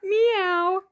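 high-pitched kitty meow; cat meow. Shown as a demonstration. Parent showing toddler a cat sound, high meow. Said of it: "What does a cat say?".

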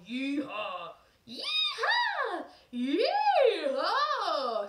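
wild, very high, loud, laughing "yeehaw"; crazy yeehaw. Shown as a demonstration. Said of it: "Something crazy like that".

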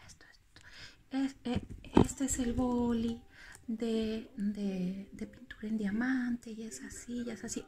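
soft near-whisper demo; talking softly. Shown as a demonstration. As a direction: keep it down, as if not to disturb the recording. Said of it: "hablando así bajito".

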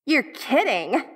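usual speaking voice

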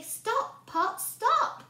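high, crisp magic words; stop spell. Shown as a demonstration. Teacher showing children the stop words, high.

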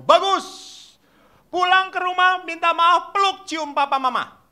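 high, loud urging; rousing priest command. Acted. Priest orders children to hug their parents, high loud urging.

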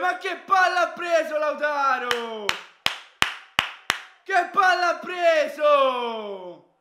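loud high shout of amazement; ecstatic shout. Real, not acted. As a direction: yell it in wonder. Fan marveling at goal.

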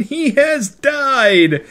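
high, slow, drawn-out proclamation; triumphant announcement. Real, not acted. Host announcing the death tally, triumphant and drawn out. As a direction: stretch it out high like a big reveal.

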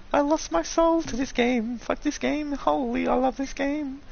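high-pitched mock-tragic narration; dramatic high narrator. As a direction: lament it like a soap narrator.

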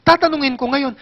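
normal talking voice

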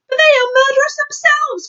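high, loud protest; army chief crying out in objection; indignant cry. Acted. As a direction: protest hotly at the top of the voice. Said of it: "cried the head of the army".

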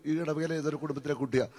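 ordinary delivery

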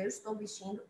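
ordinary talk

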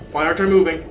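panicked. Real, not acted. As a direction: yelp in real panic. Stuck while being attacked.

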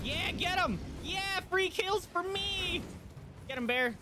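excited cheering. Real, not acted. Player cheers the bear attacking enemies, excited. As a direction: egg the bear on gleefully.

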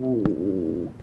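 low, soft zombie moan; zombie impression. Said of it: "that was my zombie impression".

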